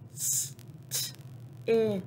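segment the word sound by sound; phonemes broken apart. Shown as a demonstration. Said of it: "break it into parts".

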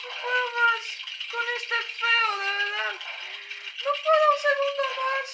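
desperate. Real, not acted. Desperate in front of monster.

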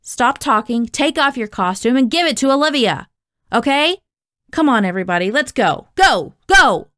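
exasperated director hurrying everyone along; angry orders. A narrator's reading. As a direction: bark orders, building to a shout. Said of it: "Davenport said to me angrily".

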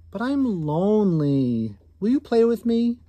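a touch of mock sadness, then a hopeful ask; lonely plea. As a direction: pull on mom's heartstrings. Boy pleads loneliness.

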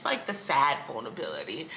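usual speaking voice

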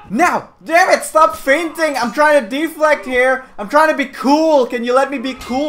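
loud, high frustrated protest; exasperated pleading. Real, not acted. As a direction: complain loudly at the opponent. Pleads with opponent while losing.

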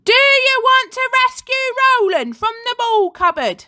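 high, loud rabble-rousing call; rousing cry. A narrator's reading. Girl on the climbing frame rouses her classmates, loud. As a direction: fire up the crowd.

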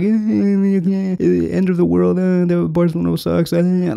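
high, whining mock child voice; whiny children. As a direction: mimic a crybaby fan whining about the club. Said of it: "like children".

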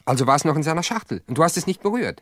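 alarmed, insistent interrogation; urgent alarmed question. Acted. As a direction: press her hard and fast for the answer. Pressing her urgently on details.

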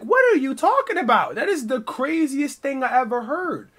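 high, loud, incredulous protest; loud disbelief. Retold or imitated. Accused roommate to the police, high-pitched disbelief. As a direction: protest as if the charge is absurd.

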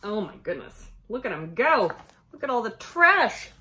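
ordinary delivery

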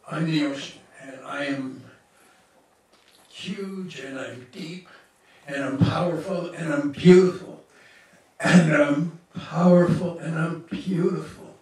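loud, building declaration, slowing on the last lines; triumphant proclamation. Retold or imitated. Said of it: "let us know what it's like to be this ocean".

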